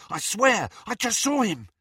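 high, breaking-out protest; excited insistence. A narrator's reading. Student tells friends about seeing Harry, excitedly.

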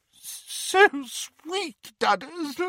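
very high-pitched tearful wail; sobbing. A narrator's reading. Aunt Petunia weeping into her son's chest, sobbing. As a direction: blubber it out in a high, weepy voice. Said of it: "she sobbed into his massive chest".

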